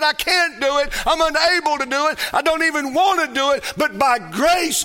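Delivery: high, loud, quick outpouring; impassioned testimony. Acted. Preacher voices the saved who confess they can't do it, impassioned. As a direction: voice the helpless believer at full force. Voicing those who plead grace.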